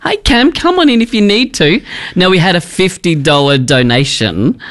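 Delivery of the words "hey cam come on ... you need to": calling out. Real, not acted. Calling to latecomer at the door.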